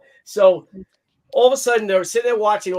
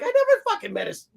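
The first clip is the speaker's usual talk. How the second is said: high, fast defensive protest; mock defensive; faster than usual. Husband defending himself to his wife, mock defensive. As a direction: rush out a pitched-up excuse.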